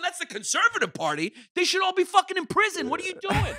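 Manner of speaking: hammed-up disbelief at the answer; mock outrage. Tourist hearing neo-Nazis are the Conservative Party, mock outraged.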